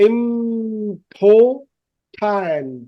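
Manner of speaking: very slow "ইম্পো-", the M held through the nose; nasal-sound demo. Shown as a demonstration. Teacher demonstrates the nasal sound, humming the "ইম" of "ইম্পর্টেন্ট". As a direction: break "ইম্পর্টেন্ট" into long separate syllables. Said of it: "নাক দিয়ে sound দিতে".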